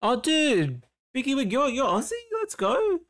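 high, delighted exclamations, climbing on the cheer; real burst of excitement at a happy discovery; excited surprise. Real, not acted. Streamer finds out a viewer is Aussie, excited.

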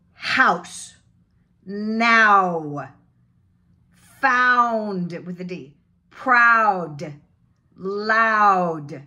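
loud, stretched AH-to-OO in every word; drawn-out diphthong. Shown as a demonstration. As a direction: model House, Now, Found slowly with a big open OW. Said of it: "Like it's a diphthong".

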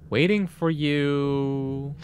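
slow, stretched-out read; drawn-out reading. Acted. Reading the words "waiting for you" off the screen, drawn out. Reads out a game message.

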